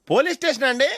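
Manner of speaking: high, eager phone complaint to police. Acted. Phoning police to report drunk. (పోలీసులకు ఉత్సాహంగా ఫిర్యాదు)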